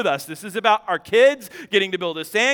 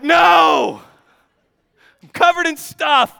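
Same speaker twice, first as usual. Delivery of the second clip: much higher and louder, far from usual; furious yell; 7 semitones higher, 6 dB louder, slower than usual. Retold or imitated. Husband yelling back at his wife, angry shout. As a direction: shout it, fed up and disgusted. Answering wife, covered in mess.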